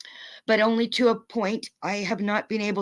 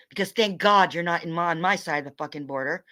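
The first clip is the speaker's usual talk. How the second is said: ordinary delivery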